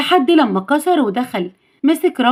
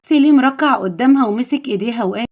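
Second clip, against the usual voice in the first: usual speaking voice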